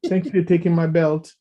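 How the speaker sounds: imitated polite thanks. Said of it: "she was like".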